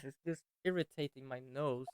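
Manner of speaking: normal talking voice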